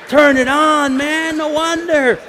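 high, loud cry of disbelief; comic exasperation. Retold or imitated. Preacher reacting to the old man's discovery, exasperated shout. Preacher exclaims over hearing aid off.

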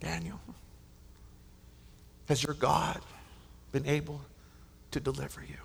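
soft, trembling call into the den; hushed anxious call. Retold or imitated. King calls softly into the den for Daniel. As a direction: call his name gently, afraid of the answer. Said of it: "He cries out".

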